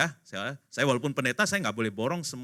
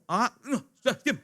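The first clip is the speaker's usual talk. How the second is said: high, louder mock interruption; impatient shushing; 10 semitones higher, 5 dB louder than usual. Spouse silencing papa as he starts to talk, impatient. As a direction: cut in and shush him, hammed up.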